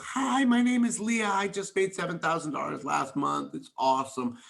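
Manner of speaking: imitating a bubbly girl showing off; gushing new recruit. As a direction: play the excited recruit bragging about her month. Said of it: "this girl would come in and go".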